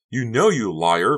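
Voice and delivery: slow, loud mock shriek. Becca accuses Honey, slow loud mild shriek. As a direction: spit out liar. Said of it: "Becca shrieked".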